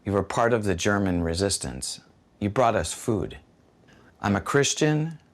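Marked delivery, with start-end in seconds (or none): none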